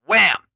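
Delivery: very loud hit; wham effect. A narrator's reading. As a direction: slam it. Impact effect.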